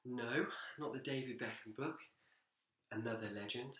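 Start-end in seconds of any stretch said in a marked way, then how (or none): none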